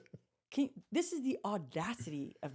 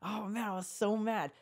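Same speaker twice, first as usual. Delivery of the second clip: usual speaking voice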